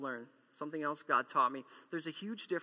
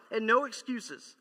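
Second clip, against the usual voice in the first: usual speaking voice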